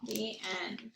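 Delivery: put-on lively read-aloud for young children; story narration. A narrator's reading.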